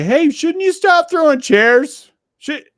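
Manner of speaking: high, slow, loud mock plea; voicing a baffled onlooker, high and drawn out; mock incredulous bystander. Mock bystander in wrestling metaphor.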